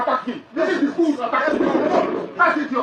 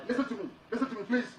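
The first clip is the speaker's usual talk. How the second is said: quiet plea; 2 semitones lower, 13 dB quieter, much faster than usual. Real, not acted. Defendant pleading with judge in court.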